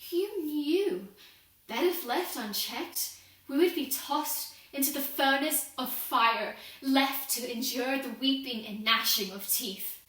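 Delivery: loud, forceful indictment of God; bitter accusation. Acted. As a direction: hammer out the blame with full voice.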